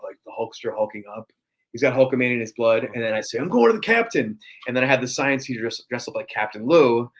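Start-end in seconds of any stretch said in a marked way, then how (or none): 3.4-4.4 high, loud wrestler promo; Macho Man impression. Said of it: "So we did C S I Macho".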